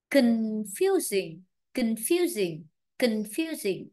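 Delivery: "confusing" slowly, stressed on FU; con-FU-sing, drawn-out model of "confusing"; model word stress. Shown as a demonstration. Teacher shows how to stress it properly, model word stress. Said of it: "ta phải biết cách đánh trọng âm".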